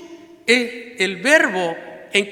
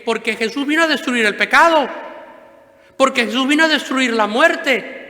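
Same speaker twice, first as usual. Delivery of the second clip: raised, emphatic preaching; preacher declares victory over sin and death; preaching fervor; 4 semitones higher, 5 dB louder than usual. Acted. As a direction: proclaim it to the congregation. Preaching climax.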